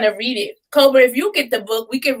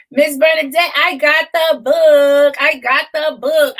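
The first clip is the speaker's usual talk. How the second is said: louder, higher, drawn-out shout-out; proud announcement; 4 semitones higher, 4 dB louder, slower than usual. Real, not acted. Host telling Miss Bernadette about the book, proudly excited. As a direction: stretch it out proudly to the author.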